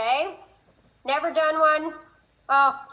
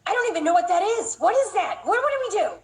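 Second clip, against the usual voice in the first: higher, faster panicked questions; flustered radiologist; 5 semitones higher, 3 dB louder than usual. Retold or imitated. Radiologist panics over an unknown exam, mock flustered. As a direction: play the confused doctor, rising in alarm. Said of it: "they'd be like".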